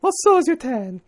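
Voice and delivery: high falsetto lady retort; man's high-pitched lady impression. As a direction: answer back in the put-on lady voice. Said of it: "Your lady voice is kinda muddled".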